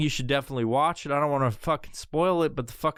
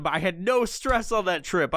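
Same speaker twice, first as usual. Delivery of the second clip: usual speaking voice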